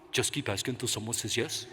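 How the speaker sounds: put-on low, soft Spanish-accented echo; imitating mentor's accent. Victor echoing the mentor's words back in his Spanish accent. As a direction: repeat his line in his suave voice. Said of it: "are you telling me".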